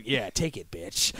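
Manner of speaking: growl-free low taunt, cocky; Snake voice. Pretend. Snake beating the guard.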